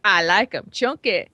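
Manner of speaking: mimicking a song's vocal hook; playful take on the singer; imitating song lyric. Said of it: "singing about how I like 'em chunky".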